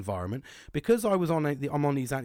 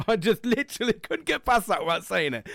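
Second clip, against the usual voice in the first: laughing; 8 semitones higher, 4 dB louder than usual. Real, not acted. Laughs at his own joke.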